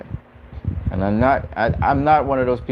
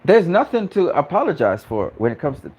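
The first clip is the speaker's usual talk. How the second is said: normal talking voice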